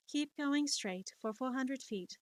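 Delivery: slow, soft automated satnav; GPS navigator voice. Acted. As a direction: guide the driver like a satnav, slowly. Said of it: "it's just how I read directions".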